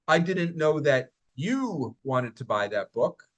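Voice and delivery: you lifted and held, slow; single out you; stress on you. Shown as a demonstration. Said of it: "listen to how I say these".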